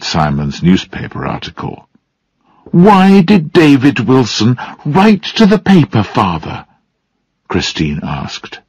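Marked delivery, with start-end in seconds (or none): 2.9-6.7 higher-pitched, insistent questioning; challenging question. A narrator's reading. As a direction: press father for an answer. Daughter challenges her father.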